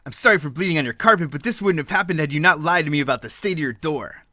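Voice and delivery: ordinary talk, nothing marked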